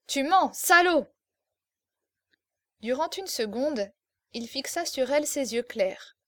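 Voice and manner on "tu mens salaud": much higher, louder furious yell; furious shout. Acted. Exasperated girl yelling at the officer, furious shout. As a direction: scream it in his face. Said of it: "lui cria dans la figure".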